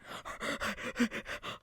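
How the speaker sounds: a breathy gasp for air; mock gasp. Winded on the steps, a mimed gasp. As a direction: gasp like the stairs winded you.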